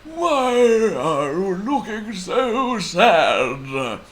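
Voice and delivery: slow, high, loud wizard voice; Timbuktu Wizard. A narrator's reading. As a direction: draw it out like a mysterious wizard. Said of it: "It was the Timbuktu Wizard".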